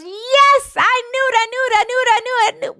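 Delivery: very high, gleeful repeated cheering; a high, giddy old man rejoicing; triumphant cheer. Acted. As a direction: celebrate like your team just scored. Friend celebrates football in heaven.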